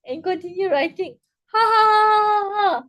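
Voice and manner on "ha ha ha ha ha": loud, high staged laugh; forced rhythmic ha-ha to relieve stress; time management laughter. Shown as a demonstration. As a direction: laugh deliberately, starting high. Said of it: "And laugh".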